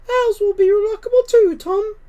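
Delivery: high, firm mother cat voice; a proud mother cat sending her kittens off. Acted. Mother cat telling her kittens to leave, high and firm.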